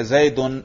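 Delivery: زیدن said "Zaydun", with a u; nominative ending. Shown as a demonstration. Teacher showing the class audible case endings, زیدن as "Zaydun". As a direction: end زیدن in "-un". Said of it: "میں نے رفع پڑھا".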